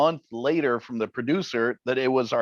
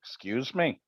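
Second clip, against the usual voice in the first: softer, sheepish little apology; sheepish excuse me; 7 dB quieter than usual. Retold or imitated. Host imitating a caught-out colleague on the tape, soft sheepish apology. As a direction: mumble it, caught out. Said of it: "you hear Barry say".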